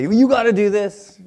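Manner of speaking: loud, high hammed-up order; mock pushy demand. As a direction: press them hard, loudly, as a caricature. Mimics himself forcing someone.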